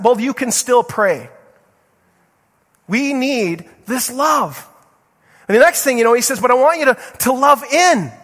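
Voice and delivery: preaching at full force. Acted. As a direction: urge the church, fervently. Preacher urging prayer and groups.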